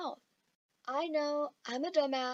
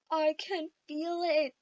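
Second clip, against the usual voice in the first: mock despairing; 5 dB louder than usual. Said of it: "I'd look down and go".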